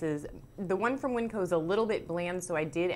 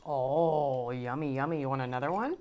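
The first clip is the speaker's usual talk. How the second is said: pet talk voice; 5 semitones lower than usual. Real, not acted. Cooing to her dog Rocky.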